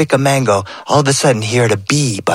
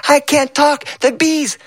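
put-on higher, breathless frightened child; scared boy; 13 semitones higher than usual. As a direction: shout it while running from bees. Boy fleeing bees.

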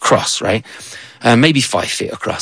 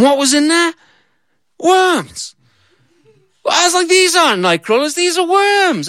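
much higher, outraged disbelief; indignant surprise. Retold or imitated. Opening the bait box to find plain worms, shrill disbelief. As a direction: cry out at the letdown. Lid opened, only worms inside.

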